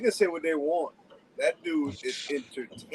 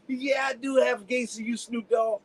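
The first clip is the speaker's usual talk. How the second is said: lightly imitated radio rival, higher; Fireball; 7 semitones higher, 4 dB louder than usual. Friend retelling a radio rival's comeback, lightly imitated. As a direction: retort in a rival's voice, pitched up. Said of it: "When I explained to him".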